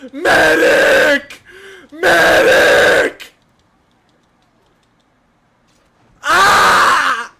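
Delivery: high, loud desperate cry for a medic; man down shout. Real, not acted. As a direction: scream for a medic, pinned down. Calling for help in a fight.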